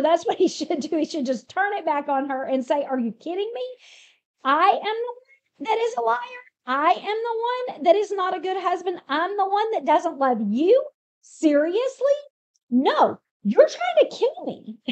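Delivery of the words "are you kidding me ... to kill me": high-pitched, incredulous comeback; indignant disbelief. Retold or imitated. Husband turning it back on the wife who accuses him, indignant. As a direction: turn the blame back, mock-outraged.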